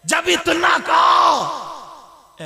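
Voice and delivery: drawn-out preaching cry; long wail. A narrator's reading. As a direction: howl it out like a fiery preacher. Preacher's wailing climax.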